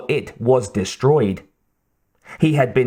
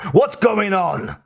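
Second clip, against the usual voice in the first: slow, raised-pitch shout; man's shout; 9 semitones higher, slower than usual. A narrator's reading. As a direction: call out high, demanding an answer. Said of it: "the man shouted".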